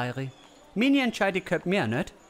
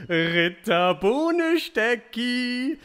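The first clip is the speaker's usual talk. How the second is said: higher, very slow, stretched call with a rising end; drawn-out summons; 6 semitones higher, 3 dB louder, much slower than usual. Acted. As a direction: sing out the knight's name lazily. Gessler summons the knight again.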